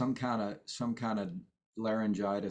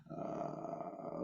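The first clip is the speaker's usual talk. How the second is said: low creaky vocal fry; 10 dB quieter than usual. Shown as a demonstration. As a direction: creak out a low fried note. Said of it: "Here we go".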